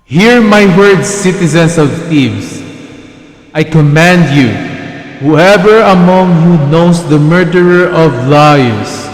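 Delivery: loud, commanding declamation; royal proclamation. Acted. Oedipus commanding the citizens of Thebes, loud proclamation. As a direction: address a whole crowd with a king's authority.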